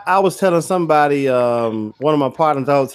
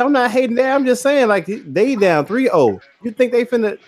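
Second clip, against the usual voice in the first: imitating daughter, pitched higher; lightly voiced daughter pushing back in a higher pitch; daughter's voice; 7 semitones higher than usual. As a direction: retell the daughter's protest in a raised pitch. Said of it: "She said".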